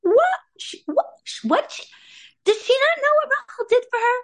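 high-pitched, stammering disbelief; mock-outraged squeal of disbelief; incredulous reaction. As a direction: sputter in mock shock, voice leaping up. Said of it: "we're all more like".

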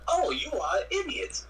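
high-pitched exasperated rebuke; mock scolding. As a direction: scold the fool in a swooping high voice. Acted scolding of job quitters.

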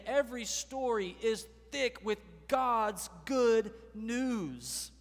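ordinary talk, nothing marked

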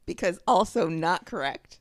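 ordinary delivery

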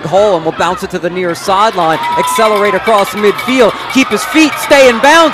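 building to a full-throated shout as he breaks free; touchdown call. Real, not acted. Live touchdown run, rising call.

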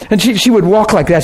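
ordinary talk, nothing marked

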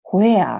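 "where" drawn out as katakana "hoea"; "where" in heavy Japanese pronunciation, stretched out; old-fashioned katakana English. Retold or imitated. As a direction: imitate an old teacher saying "where" slowly as "ho-e-a". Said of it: "っていうような先生もいましたね".

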